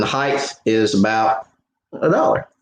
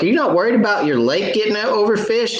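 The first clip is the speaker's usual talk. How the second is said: higher, stressing not in disbelief; worried disbelief; 11 semitones higher than usual. Retold or imitated. Questioning the officer about overfishing, higher and incredulous. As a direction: press him, amazed he isn't worried. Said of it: "I was like".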